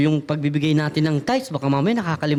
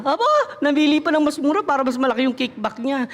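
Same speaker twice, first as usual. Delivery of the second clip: mock indignant mother; 6 semitones higher than usual. Mother catching child's trick.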